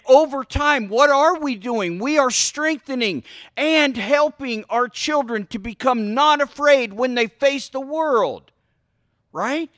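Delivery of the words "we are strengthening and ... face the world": ordinary talk, nothing marked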